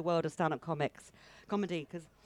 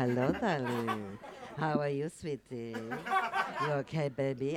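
put-on lower, slow flirty Russian accent; 6 semitones lower, slower than usual. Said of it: "I'd be a Russian woman".

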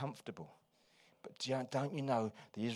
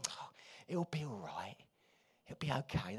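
hushed soothing whisper; consoling whisper; 7 dB quieter than usual. Retold or imitated. Friend with an arm around you, whispering comfort.

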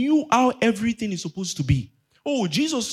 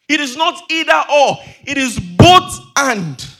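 loud, emphatic sermon proclamation; full-force preaching; 2 semitones higher, 10 dB louder than usual. Real, not acted. Preacher proclaims the kingdom, teaching and healing, forceful preaching. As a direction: thunder the key words at the congregation.

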